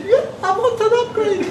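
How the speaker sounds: high eager yes with a laugh; high-pitched, laughing eager reply. Retold or imitated. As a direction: jump at the offer, high and laughing.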